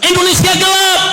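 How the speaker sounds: high-pitched slogan shout; ringing, sudden protest yell; student protest cry. Retold or imitated. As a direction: imitate a demonstrator crying out. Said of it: "mahasiswa bilang".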